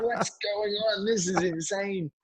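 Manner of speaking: can't take it in; stunned. Retold or imitated. Retold disbelief in club.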